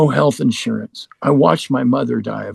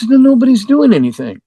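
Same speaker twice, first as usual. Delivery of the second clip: retold exasperated protest, louder and higher; frustrated outburst; 10 semitones higher, 5 dB louder than usual. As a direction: blurt it out, fed up with waiting. Complaining to doctor why no treatment.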